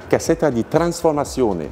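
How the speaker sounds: slow, self-conscious Italian accent. Shown as a demonstration. Mechanic naming an old Abarth kit for a visitor, Italian accent. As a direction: attempt an Italian accent, stretching the syllables. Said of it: "pour mon accent italien".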